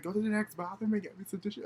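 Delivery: high, loud mimicked command; demanding. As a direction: call it out high, imitating a bossy friend. Friend orders her to fetch tissue.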